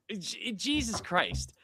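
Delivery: normal talking voice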